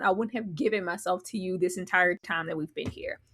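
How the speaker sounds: quiet wounded confession; hushed, hurt; hurt whisper. Retold or imitated. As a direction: whisper it, betrayed. Voicing Trish's hurt.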